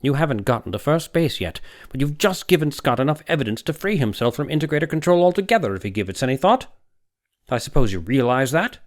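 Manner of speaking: put-on young doctor, scornful. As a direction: needle the older man with contempt. Young doctor voiced, scolding.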